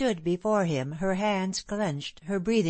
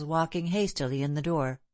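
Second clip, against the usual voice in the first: plain reading voice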